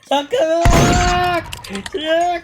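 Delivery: high, drawn-out yell of a name. Real, not acted. Player to teammate mid-game, yelling his name. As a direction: yell it long and high, urgently.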